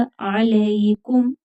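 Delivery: अलैकुम slow, throat ain, light kaf; tajweed demo. Shown as a demonstration. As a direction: stretch अलैकुम carefully. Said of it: "काफ बारीक पढ़ा जाएगा".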